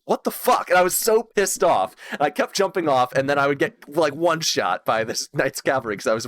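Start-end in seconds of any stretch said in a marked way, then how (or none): 0.1-0.7 frustrated what the fuck. Retold or imitated. Knocked off horse again.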